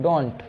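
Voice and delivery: don't said as one syllable for "do not"; contracted pronunciation. Shown as a demonstration. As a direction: model the contraction don't for a class. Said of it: "do not को आप बोल सकते हैं". (don't को एक ही शब्दांश में बोलें)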